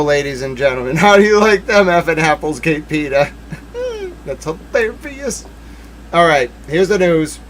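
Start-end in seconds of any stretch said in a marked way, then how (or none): 3.8-5.4 squeaky giggle then soft amused words; high-pitched laugh. Real, not acted. Streamer cracking up at a joke, high giggle.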